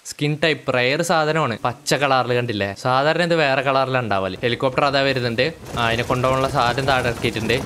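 ordinary talk

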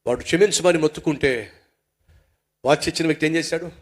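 ordinary delivery

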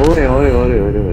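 urgent loud callout; calling out loudly. Real, not acted. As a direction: shout to grab attention. Calling out mid-fight.

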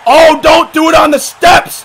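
high, loud, drawn-out plea; slow, stretched ringside yell; alarmed shout. Real, not acted. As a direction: cry out in dread at the coming move. Dreads a dangerous move.

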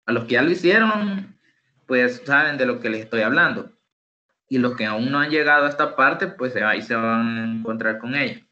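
normal talking voice

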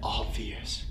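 whispered echo of a heard word; hushed repeat. Real, not acted. Investigator repeats a possible spirit word, whispered. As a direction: repeat it under your breath, very low.

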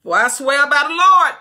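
high, loud sworn threat; fierce oath shout. Acted. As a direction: swear it in the king's face. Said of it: "Joab said".

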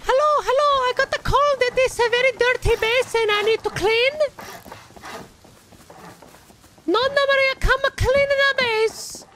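put-on lightly done Italian nonna accent, broken English; an old Italian cleaning lady pleading at the door in a mild Italian accent; Nonna Maria Italian accent. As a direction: play a little old Italian grandma who wants to clean, thick Italian accent. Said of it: "I can naturally do a good Italian accent".

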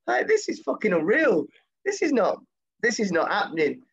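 high, slow stunned exclamation; stunned disbelief. Retold or imitated. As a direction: replay the shock of meeting your hero. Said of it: "I'm going".

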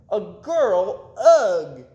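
put-on high childish voice ending in a loud ugh; disgusted boy. A narrator's reading. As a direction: groan out the ugh like a grossed-out kid. Boy disgusted at girl arriving.

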